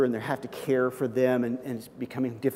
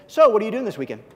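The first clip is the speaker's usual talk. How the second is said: casual question; 5 semitones higher, 6 dB louder, faster than usual. Shown as a demonstration. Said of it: "You ready?".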